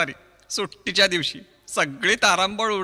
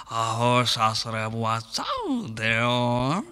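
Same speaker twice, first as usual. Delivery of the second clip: slow low drawling character voice; 7 semitones lower, slower than usual. Acted. Mimic addressing father-in-law.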